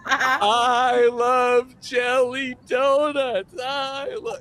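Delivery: high, slow, drawn-out wail; struggling recruit's shout. Retold or imitated. Recruit nearly broken, high drawn-out donut cry. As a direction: drag it out high as the suffering recruit. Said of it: "he nearly broke this kid".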